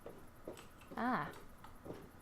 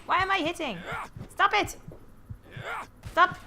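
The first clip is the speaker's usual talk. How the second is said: louder and higher, exasperated; frustrated shout; 6 semitones higher, 4 dB louder than usual. Real, not acted. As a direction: yell at the game in frustration. Character attacks on its own.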